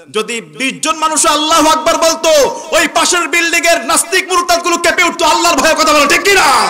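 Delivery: roaring rally cry from the pulpit; full-force preaching. Real, not acted. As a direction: rouse the crowd at the top of your voice.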